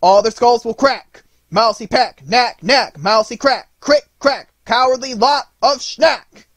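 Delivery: high, very loud chanted battle cry; top-of-the-voice shouting. A narrator's reading. Nutcracker springing up to insult the mice, full-voiced shouting. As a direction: hurl each word out at full volume. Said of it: "crying out at the top of his voice".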